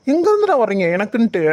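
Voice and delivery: normal talking voice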